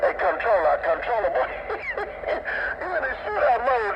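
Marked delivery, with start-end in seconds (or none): 1.5-2.7 airy high-pitched giggle; breathy high laugh. Real, not acted. Teasing the controller on the radio with a high giggle.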